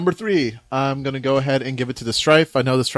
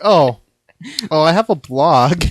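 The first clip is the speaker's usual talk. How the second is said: sheepish self-quote; 3 semitones higher, 3 dB louder, slower than usual. Retold or imitated. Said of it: "I'm like".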